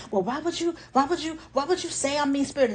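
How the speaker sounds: defensive mimic. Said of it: "Now, you never said it".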